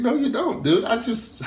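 high, loud inner retort, amazed; excited disbelief. Retold or imitated. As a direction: react like you just won the lottery. Inner reaction to rival's remark.

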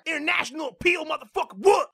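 high-pitched impression of a fighter; Diaz brothers. Fighter cutting into an interview, mock-combative. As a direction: swear in a put-on brawler voice, popping up high on the end. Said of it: "Why are the Diaz brothers there?".

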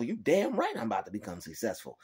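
ordinary delivery

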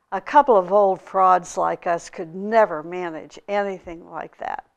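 normal talking voice

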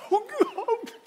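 high, mock-sobbing cry to God; hammed-up weeping prayer; imitated tearful worship. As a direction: imitate a friend overcome with tears. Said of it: "he's crying".

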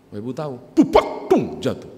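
pupak pung said as a thud; mock fall sound effect. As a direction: voice the bump of falling. (tiruan bunyi jatuh)

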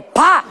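loud, high "pa" burst; sudden explosive vocal pop; popping bang. Retold or imitated. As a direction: imitate a balloon exploding. Imitates the balloon bursting.

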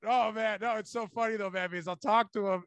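usual speaking voice

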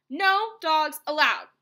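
loud, slow command; stern yell. A narrator's reading. Hank bans a passing coyote, yelling slowly. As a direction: shout each word as a ban. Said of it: "Hank yelled".

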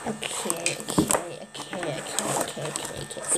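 low whispered repeated okays; whispered self-calming. Real, not acted. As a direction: whisper to steady yourself.

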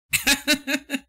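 high giggling laugh; a sudden high laugh at the screen; burst of laughter. Real, not acted. Streamer cracking up at a friend's drawing, high laugh.

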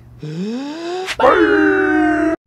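long, high, loud theatrical gasp. Acted. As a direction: gasp in shock at the blow. Dramatic reaction in story.

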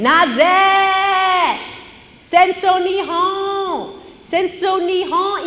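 high, slow, loud shouting in Japanese; high drawn-out shout in Japanese. Retold or imitated. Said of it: "Even louder".

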